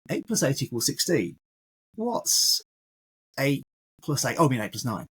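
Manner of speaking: teacher's planted mistake; deliberate slip. Shown as a demonstration. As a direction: say eight plus eight, pause, then correct to eight plus nine. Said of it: "That's a subtle change".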